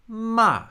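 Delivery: Ma starts very high and falls at once; fourth tone. Shown as a demonstration. Teacher shows the fourth tone falling from first-tone height. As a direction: drop Ma the moment it starts. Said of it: "the pitch is gonna fall".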